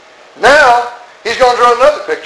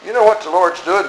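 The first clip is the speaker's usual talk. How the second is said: normal talking voice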